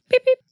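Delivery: very high squeaky beep-beep; mock beep sound effect. Shown as a demonstration. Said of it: "Here's the blooper sound effect".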